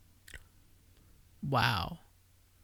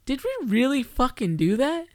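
high, loud disbelief; incredulous outburst with a mind-blown emphasis; 9 semitones higher, 5 dB louder than usual. Acted. Co-host reacting to a startling realization, in loud, high disbelief. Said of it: "your mind's just blown".

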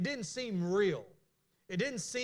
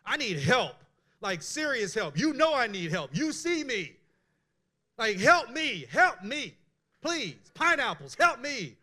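casual desperate prayer; 3 semitones higher, 7 dB louder, slower than usual. Pretend. Preacher showing God how he'd really pray, loud pleading. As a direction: beg God for help like a friend. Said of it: "I'd be like".

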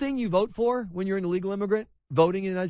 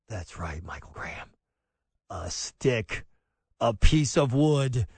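mock scornful parent; 8 semitones lower, slower than usual.

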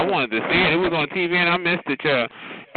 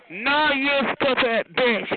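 mock angry retort; 7 semitones higher, slower than usual. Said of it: "And she said".

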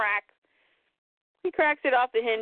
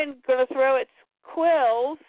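normal talking voice

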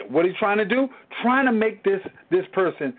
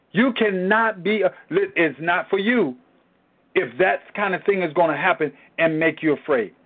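ordinary talk, nothing marked

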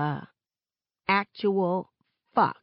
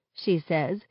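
ordinary delivery

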